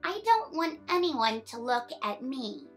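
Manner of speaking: ordinary delivery, nothing marked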